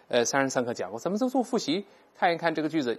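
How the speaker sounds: ordinary delivery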